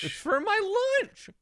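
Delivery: very high, drawn-out mock whine; mocking imitation. Mimicking the train lady's lunch excuse, mocking. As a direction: stretch it into a high, silly protest.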